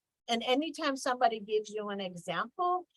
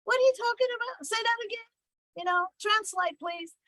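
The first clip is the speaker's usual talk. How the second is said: much higher, incredulous what?; baffled; 11 semitones higher than usual. Pretend. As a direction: play it like the pitch made no sense at all. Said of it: "I would say".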